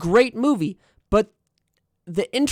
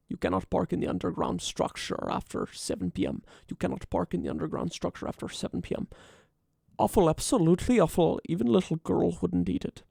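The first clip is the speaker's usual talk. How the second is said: much softer, menacing Bardem voice; hushed killer voice for a parking warning; Javier Bardem impression; 6 dB quieter than usual. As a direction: say it quiet and cold, like a movie killer. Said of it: "then it's starting to become, um, Javier Bardem".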